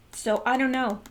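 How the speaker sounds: normal talking voice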